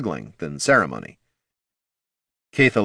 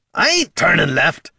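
loud angry dwarf yell; gruff shout; 10 semitones higher, 4 dB louder than usual. Acted. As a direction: shout back at the machine. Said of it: "to shout at the mechanical gazer".

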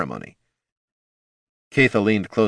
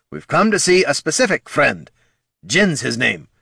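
gruff dwarf voice; 7 semitones higher, 3 dB louder than usual. Acted. Gorm speaks.

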